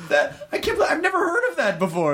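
high, laughing disbelief; can barely get it out for laughing. Real, not acted. Host hearing about the school musical, laughing in disbelief. Reacting to Dracula Spectacular.

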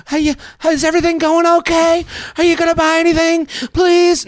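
high, loud mock pushy shop assistant; pushy salesclerk. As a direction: falsetto mock of a nagging store employee. Pushy store clerk voiced in ad.

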